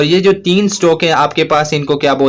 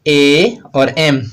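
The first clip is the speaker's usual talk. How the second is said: slowly spelled letters; much slower than usual. Shown as a demonstration. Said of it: "जी".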